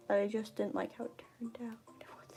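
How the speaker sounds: fast breathy whisper. Real, not acted. Whispering while re-recording.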